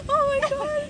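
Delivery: high shrieking laugh-cry; hysterical laughter. Real, not acted. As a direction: lose it completely laughing. Laughing after bird theft.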